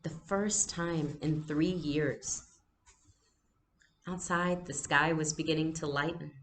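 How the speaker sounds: plain reading voice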